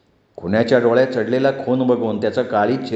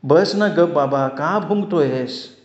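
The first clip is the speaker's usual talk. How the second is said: drawn-out, higher-pitched exasperation; annoyed; 4 semitones higher, slower than usual. A narrator's reading. Lakshmi hushing the barking dog, annoyed. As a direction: scold with a frown, stretching the words. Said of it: "कपाळाला आठी घालून म्हणाली".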